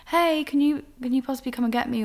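quick, lifted voice asking a favour; calling a loved one. Retold or imitated. Recalling calls to a partner for a lift, casual asking. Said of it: "someone that you can call and be like".

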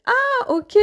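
high-pitched "aha" of understanding; acted sudden realisation. Teacher voicing a learner who reads the subtitle, high acted realisation. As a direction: act out the penny dropping.

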